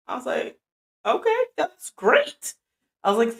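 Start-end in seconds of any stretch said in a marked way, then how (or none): 1.1-2.5 high, slow, put-on polite voice; a fake-cheerful okay, stretched out; mock polite. Retold reaction to vague plan.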